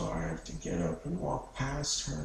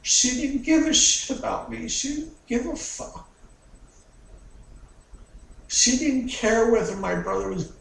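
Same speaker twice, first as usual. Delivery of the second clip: loud, high, raw anger spilling out; shouting out years of hatred; furious outburst; 9 semitones higher, 7 dB louder than usual. Acted. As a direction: rage at the mother who never cared. Raging confession about mother.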